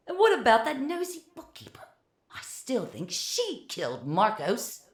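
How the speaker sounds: high screechy Philip, but quiet; a mild high screech of alarm. A narrator's reading. Philip screeching to Francois behind the door, high. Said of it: "Philip screech".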